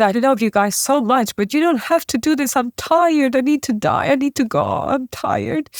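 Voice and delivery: high-pitched, weary pleading; desperate retold plea. Pleading with parents to let go.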